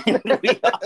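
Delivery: bursting into high laughter mid-sentence; real laughter takes over the words; laughing. Real, not acted. Laughing at the memory.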